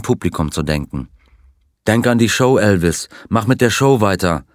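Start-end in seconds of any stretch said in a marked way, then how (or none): none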